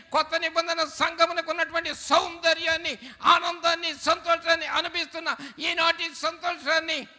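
high, ringing preacher's exhortation; full-force preaching. Acted. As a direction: preach it out high over the crowd.

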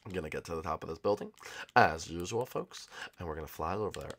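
very soft, low sneaking voice; hushed secretive whisper. Acted. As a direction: keep it down so the sleepers don't wake. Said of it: "I don't have to whisper anymore".

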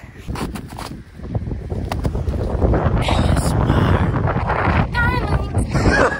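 airy wheezing laugh up high; high breathless laugh. Real, not acted. Pushing up snowy hill.

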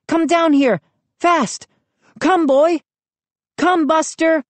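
high, pressing commands to a dog; urgent calling. A narrator's reading. Trapped boy calling his dog down, urgent calling. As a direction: call out as if time is short.

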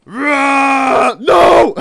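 loud, high yell; roar, then shriek out in alarm; startled scream. Real, not acted. Sudden scare in the water.

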